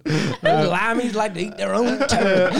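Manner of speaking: slow, high, loud hammed-up drawl; mock American local. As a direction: sneer at foreigners as a joke yokel. Joins the riff as American local.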